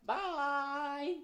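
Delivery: high, slow, timid critter voice; shy little muskrat voice, drawn out; high timid puppet muskrat; nervous puppet. Acted. Puppet Gus says bye.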